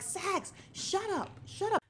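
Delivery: very high-pitched mock scream. Viewer screaming at Sax on the TV, mock scream. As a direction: re-enact yelling at a character, voice shooting up. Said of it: "I was screaming at the T V".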